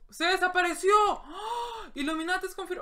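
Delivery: enemy vanishes, startled loud cry; startled shout. Real, not acted. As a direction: jump at the sight.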